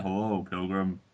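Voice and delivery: low, soft American cowboy drawl; John Wayne. Acted. Showing off his deep American voice to friends, deep cowboy impression. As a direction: drop into a deep cowboy movie voice. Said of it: "John Wayne, like".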